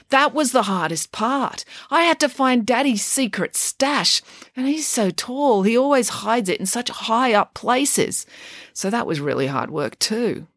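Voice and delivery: a mum milking her hard day for sympathy; hammed-up complaining mum. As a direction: play up the drama of a tough day. Mum's mock-suffering voice.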